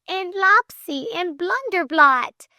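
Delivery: high, slow insistent listing; high-pitched girlish protest; crying out. A narrator's reading. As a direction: cry out each name high and drawn out. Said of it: "cried Flame".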